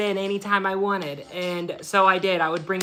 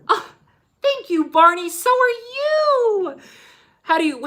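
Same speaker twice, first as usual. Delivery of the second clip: sweet kiddie voice; 13 semitones higher, 3 dB louder than usual. Pretend. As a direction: talk sweetly like to a toddler. Answering the toy Barney.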